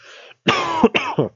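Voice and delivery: loud throat-clearing cough; real cough from voice strain. Real, not acted. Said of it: "this wreaks havoc on my voice".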